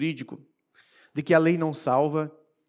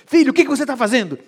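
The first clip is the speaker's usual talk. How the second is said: alto, rápido e severo; pai falando firme; 6 semitones higher, 9 dB louder, faster than usual. Acted. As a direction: chama o filho às falas. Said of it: "um pai falando firme com o filho". (pai que repreende o filho com firmeza)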